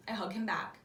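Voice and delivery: ordinary delivery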